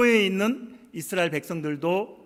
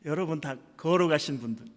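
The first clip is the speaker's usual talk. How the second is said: ordinary talk, nothing marked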